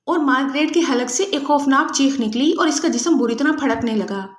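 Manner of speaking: usual speaking voice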